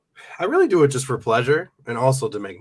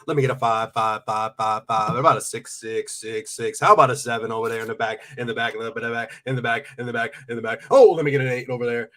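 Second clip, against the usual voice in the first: fast rattling auction call; auctioneer voice; 3 dB louder than usual. Pretend. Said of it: "do an auctioneer voice".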